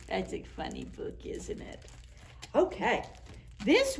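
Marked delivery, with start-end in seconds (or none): 0.1-1.8 soft aside. Real, not acted. Soft aside about book.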